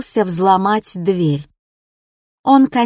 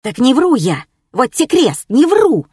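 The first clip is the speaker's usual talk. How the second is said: squealing protest, going higher; shrill; 5 semitones higher, 4 dB louder than usual. Acted. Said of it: "визгливые нотки".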